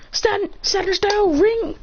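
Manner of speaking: high, drawn-out technique name; mock attack call. Inventing and calling an attack name.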